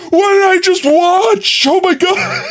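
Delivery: high, drawn-out shriek of disbelief; mock shocked outcry. Streamer reliving his reaction to the ad, mock shocked outcry. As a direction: exclaim in hammed-up horror.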